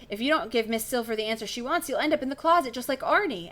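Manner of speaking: usual speaking voice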